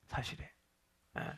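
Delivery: hushed aside to the congregation; whisper. Real, not acted. Preacher whispering that the story is true. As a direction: breathe it like a secret.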